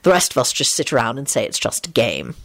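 lightly voiced low male character; low, measured verdict; pronouncement. A narrator's reading. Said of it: "Lupin pronounced".

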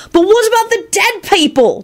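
very high, piping protest; yelling boy. A narrator's reading. Timothy objecting about the dead, high yell. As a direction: yell it high without much volume. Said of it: "Timothy yelled".

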